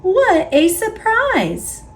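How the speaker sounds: a high, bright exclamation of joy; happy surprise at the seahorse; delighted. A narrator's reading. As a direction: say it high with wonder. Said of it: "cries Pete".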